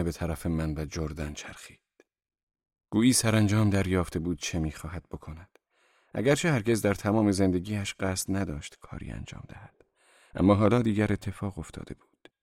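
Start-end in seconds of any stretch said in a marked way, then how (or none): none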